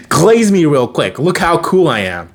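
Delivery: put-on flaunt it like the coolest kid around; show-off. Repeats evil Ben's boast.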